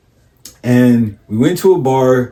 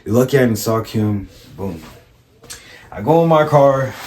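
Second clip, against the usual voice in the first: ordinary talk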